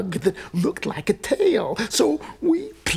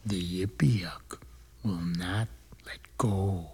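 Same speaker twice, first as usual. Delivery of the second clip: weary poem recital; 12 semitones lower than usual. Acted.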